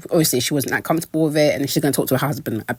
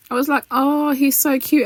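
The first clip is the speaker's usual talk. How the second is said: touched aww; 11 semitones higher than usual. Real, not acted. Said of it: "welling up".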